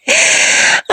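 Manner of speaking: sudden very high airy burst of laughter; unhinged little shriek of a laugh. Acted. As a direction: crack up at his helplessness. Mocking his helplessness.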